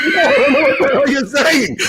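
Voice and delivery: exasperated retort. Retold or imitated. Retelling his confusion to the priest.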